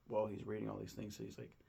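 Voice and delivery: ordinary talk, nothing marked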